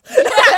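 high burst of laughter; loud high laugh. Real, not acted. Laughing at meme.

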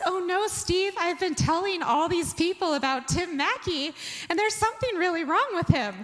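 high-pitched retold panic; alarmed to husband. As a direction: raise the voice in mock alarm to Steve. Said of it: "And so I said".

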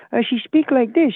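high, babyish mock Chinese accent; Olivia Chow's put-on accent. Caller showing how the mayor supposedly talks, mocking Chinese accent. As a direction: put on a little old lady's fake Chinese accent. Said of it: "fake babbling baby accent".

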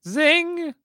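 high squeaky zing. Pretend. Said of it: "zing voice".

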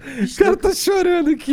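high, slow shouted teasing; mock shout. Real, not acted. Teasing his friend for laughing to tears, slow mock shout.

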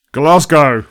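loud, blunt "Glasgow"; a deadpan shouted reply; shouted punchline. Retold or imitated. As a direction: bark the answer out. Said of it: "Manuel going".

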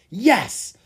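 high, loud burst of joy; excited shout. A narrator's reading. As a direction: shout it in triumph. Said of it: "Lucas shouted as he jumped up".

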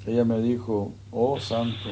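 ordinary delivery

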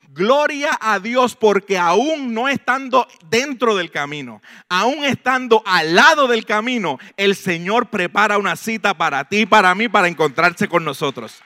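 fervent preacher's shout; preaching at full force. Real, not acted. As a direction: proclaim it to the whole church.

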